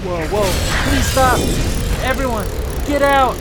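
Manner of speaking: panicked shout, high and loud; yelling while running away. Pretend. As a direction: yell it while fleeing in alarm. Fleeing the spinning shark guest.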